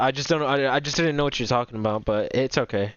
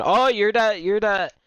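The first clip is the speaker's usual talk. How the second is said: sudden recognition; 3 semitones higher, slower than usual. Real, not acted.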